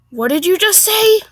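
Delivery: scandalized diner; affronted Karen. Acted. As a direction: demand it, offended and rising. Acted Karen, offended challenge.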